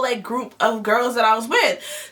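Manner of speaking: normal talking voice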